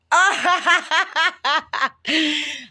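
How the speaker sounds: big laugh. Real, not acted. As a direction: laugh hard at your own pun. Laughing at own joke.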